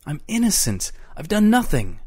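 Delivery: high-pitched desperate denial; pleading innocence. A narrator's reading. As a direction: protest to someone threatening you. Eccles pleads against a death threat.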